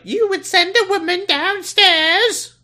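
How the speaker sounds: very high, slow, outraged lady voice; a shocked high falsetto grande dame; indignant secretary. Retold or imitated. To the men sending her downstairs, shrill mock outrage. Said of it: "she's like".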